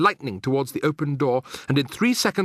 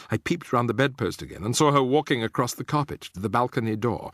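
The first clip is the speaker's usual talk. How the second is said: plain reading voice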